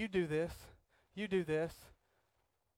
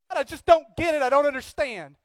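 whining; 6 semitones higher, 6 dB louder than usual. Retold or imitated. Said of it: "You're like".

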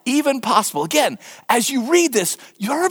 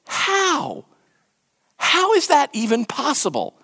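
incredulous; 5 semitones higher, slower than usual. Retold or imitated. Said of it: "make you go".

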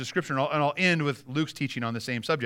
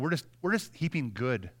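usual speaking voice

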